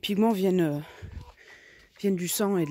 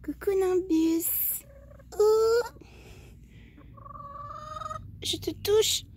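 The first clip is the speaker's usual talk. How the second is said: cooing pet talk; much slower than usual. Real, not acted. Greeting her hen Nimbus in a very high slow coo.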